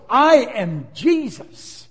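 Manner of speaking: slow, loud, weighty declaration; each word laid down slowly like a verdict; voice of Jesus. Acted. Jesus answering Saul from heaven, slow and commanding.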